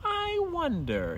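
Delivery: slow, high pondering hum; musing fox. Acted. Fox puzzling over the signpost letters, musing. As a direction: wonder slowly to yourself. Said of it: "he muttered to himself".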